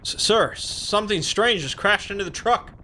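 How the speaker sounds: high-pitched, stammering report to a superior; alarmed trooper. Acted. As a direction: blurt it out in a high, startled voice.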